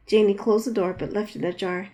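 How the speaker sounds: ordinary delivery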